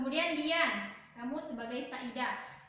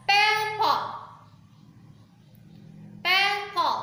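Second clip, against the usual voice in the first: each word loud and high, with a pause after it for the class; teacher drilling vocabulary aloud; model pronunciation; 4 semitones higher, 5 dB louder, slower than usual. Shown as a demonstration. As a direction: read each word out for students to repeat. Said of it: "I would like to read first and then you repeat after me".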